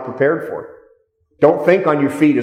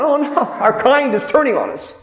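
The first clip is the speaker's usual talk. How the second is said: much higher, whiny mock panic; mock dismay; 10 semitones higher than usual. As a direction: lampoon the hecklers' shock. Agitators dismayed, mocked.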